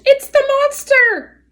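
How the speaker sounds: high, slow, loud alarm screech; frightened owl screeching at the robot. Acted. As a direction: shriek it out in panic.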